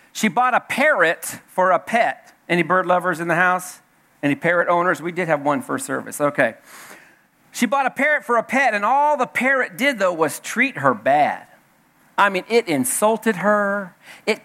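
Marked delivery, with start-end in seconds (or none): none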